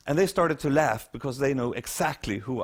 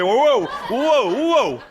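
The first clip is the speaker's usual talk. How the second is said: loud, high mock whoa from the passengers; crowd going whoa; 9 semitones higher, 8 dB louder, much slower than usual. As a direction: act out the whole bus gasping in delight. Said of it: "like this".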